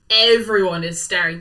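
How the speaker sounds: usual speaking voice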